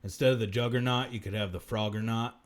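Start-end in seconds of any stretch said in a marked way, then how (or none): none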